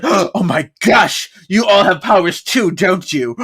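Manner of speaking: hammed-up gasping astonishment; high, loud, slow theatrical amazement; mock shock. Playing C K finding out they all have powers, mock shock. Acting C K's shocked discovery.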